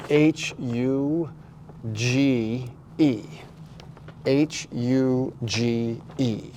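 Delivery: slow, separated letters; spelling out. Shown as a demonstration. Host spelling a written word for someone who can't see it, deliberate. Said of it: "I just spelled out".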